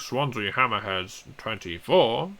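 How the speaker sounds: put-on slow, old school BBC results voice; posh English announcer. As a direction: read the scores like a posh announcer. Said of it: "old school posh English voice".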